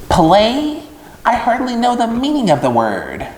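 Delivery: high, sneering rat voice; a rat sniffing at the idea; scoffing rat. Acted. Templeton mocking Wilbur's invitation, high and scornful.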